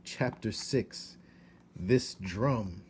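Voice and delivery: ordinary delivery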